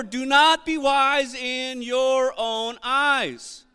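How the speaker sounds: stretched, high, stressed NOT; slow preaching emphasis; very slow emphatic reading. Shown as a demonstration. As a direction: drag "not" out with weight. Pastor repeats scripture emphatically.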